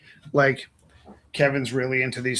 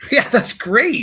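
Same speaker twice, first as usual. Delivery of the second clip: high, loud fake delight; wide eyed naive; 9 semitones higher, 6 dB louder, slower than usual. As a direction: gush like an eager child. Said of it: "You're so good at pretending human".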